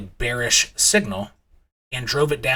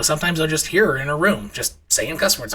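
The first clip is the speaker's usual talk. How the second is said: usual speaking voice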